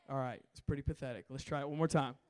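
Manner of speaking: ordinary delivery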